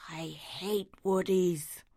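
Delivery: slow bitter whisper. Acted. Neglected dog whispering his grudge, slow. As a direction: hiss the line under your breath.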